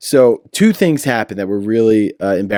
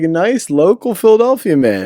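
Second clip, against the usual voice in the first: higher, hammed-up proud Philly local; mock local guy; 8 semitones higher, 3 dB louder than usual. Co-host mocks the dealer passing as a Philly local, hammed-up and higher. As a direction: sell yourself as a harmless neighbourhood guy.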